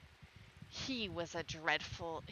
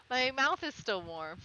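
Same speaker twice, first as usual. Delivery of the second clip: louder, stressing "mouth"; emphatic repeat; 4 semitones higher, 8 dB louder than usual. Real, not acted. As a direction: punch the closing line. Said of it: "repeats closing line, louder".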